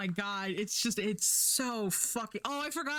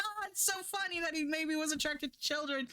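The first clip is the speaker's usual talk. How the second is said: sarcastic mockery; 9 semitones higher, faster than usual. Mocking the show's joke.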